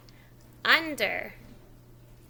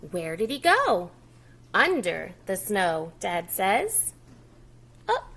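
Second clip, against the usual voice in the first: lively louder story reading; reading to children; 5 dB louder than usual. Acted. Teacher reading the book aloud to young children. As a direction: read the snow story aloud to a preschool audience.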